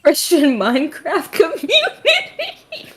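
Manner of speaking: slow words squeezed through laughter; giggling through the phrase; laughing. Real, not acted. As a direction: crack up while getting it out. Laughing at a remark.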